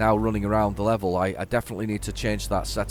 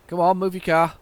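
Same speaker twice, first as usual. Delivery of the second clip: louder, higher call of impatience; impatient; 7 semitones higher, 6 dB louder than usual. Real, not acted. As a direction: urge the car ahead to move, raising your voice. Impatient at car in way.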